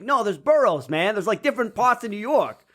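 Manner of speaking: high, lightly put-on New York local; New Yorker. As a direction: explain it like an impatient local. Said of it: "he's like".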